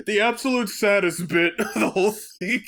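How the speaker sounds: normal talking voice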